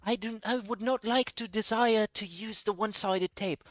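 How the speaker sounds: mock stiff polite voice. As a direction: play a stiff, prissy Phil. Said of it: "He's like".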